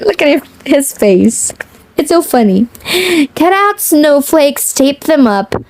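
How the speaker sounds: ordinary delivery, nothing marked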